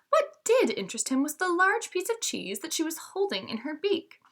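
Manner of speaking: put-on lively read-aloud for young listeners; storybook narration. A narrator's reading. Teacher reading a fable to her students, lively storytelling.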